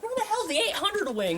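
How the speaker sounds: high-pitched lost-student voice; mock frantic. Lost student hunting for his classroom, mock frantic. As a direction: ask it high, in mock bewilderment.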